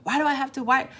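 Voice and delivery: frustrated mom repeating herself, high and fast; mock exasperated mom. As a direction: nag like a mom on her twentieth try. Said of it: "You know".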